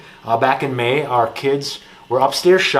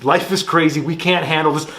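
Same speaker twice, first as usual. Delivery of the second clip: ordinary talk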